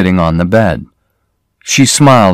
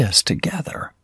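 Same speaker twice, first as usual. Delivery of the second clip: whispered; 12 dB quieter than usual. A narrator's reading. Whispered end of plea.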